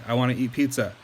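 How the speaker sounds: ordinary talk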